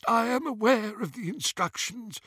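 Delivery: put-on high-pitched old goblin; dismissive goblin. A narrator's reading. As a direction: brush off the warning in a high goblin voice. Imperiused goblin answers the other.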